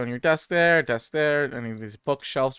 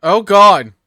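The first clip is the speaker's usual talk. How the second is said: startled; 7 semitones higher, 14 dB louder than usual. Real, not acted. Jump scare in game.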